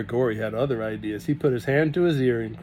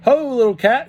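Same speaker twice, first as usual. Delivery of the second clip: higher, louder hearty greeting; hearty Russian farmhand; grinning Russian; 11 semitones higher, 9 dB louder than usual. A narrator's reading. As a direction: boom it out with a grin. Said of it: "he grinned".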